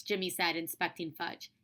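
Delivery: ordinary delivery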